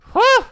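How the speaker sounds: high, loud gasp of relief; huge relieved whew. Acted. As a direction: blow out the relief in one big breath. Relief after a scare.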